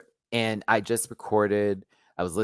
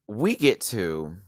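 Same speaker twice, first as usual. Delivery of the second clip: ordinary talk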